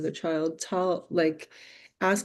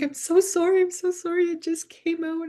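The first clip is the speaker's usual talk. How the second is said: imitating a sobbing boy, much higher; mock-crying kid's voice, pitched way up; bawling apology; 12 semitones higher, 4 dB louder than usual. Boy apologizing to teacher after swearing, imitated sobbing. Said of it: "just started bawling".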